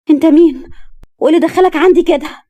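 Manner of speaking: loud, high alarmed challenge; jolted awake, she shouts at the stranger; girl woken by an intruder, loud and high; startled cry. Acted.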